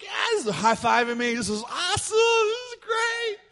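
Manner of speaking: mock crowd cheer, climbing very high at the end; crazy excitement. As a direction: imitate a class going wild, stretching the last words. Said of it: "They're going crazy".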